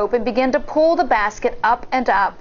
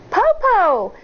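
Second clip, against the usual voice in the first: shouting; 10 semitones higher than usual. A narrator's reading. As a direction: call out, waiting for an answer. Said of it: "Tao shouted".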